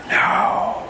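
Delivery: forceful whispered refusal; whispered reply. Retold or imitated. Arguing back at the little inner voice, whispering. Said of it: "And I whisper back".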